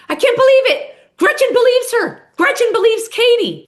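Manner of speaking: high, loud indignant disbelief; mock outraged announcement. [name] storming back in, announcing outrage in a high loud squeal. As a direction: squeal it out like a betrayed friend.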